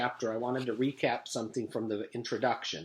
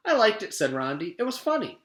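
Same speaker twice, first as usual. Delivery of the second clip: put-on cheerful kid; 8 semitones higher, 5 dB louder than usual. Rondi cheerful.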